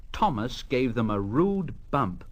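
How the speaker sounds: usual speaking voice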